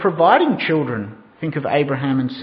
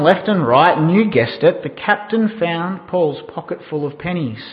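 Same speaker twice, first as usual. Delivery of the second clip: ordinary talk, nothing marked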